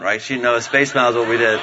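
normal talking voice

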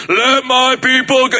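hammed-up laughing Heston decree; Charlton Heston voice. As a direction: boom it like a movie prophet. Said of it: "as opposed to Charlton Heston".

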